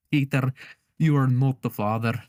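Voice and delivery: put-on show-host verdict in a muddled Russian-Scottish accent; botched Russian accent. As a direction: announce it with a fake Russian accent sliding into Scottish. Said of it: "I was doing Scottish there".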